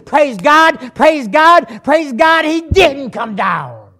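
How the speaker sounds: high, loud repeated praise; shouted preaching. Real, not acted. Preacher rejoices that Jesus stayed on the cross, shouted praise. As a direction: shout praise, slowing on the last phrase.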